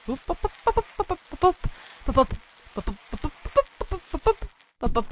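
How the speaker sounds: quick popping syllables; little bup noises. Pretend. Player filling in game noises, bup sounds. Imitated noises during play.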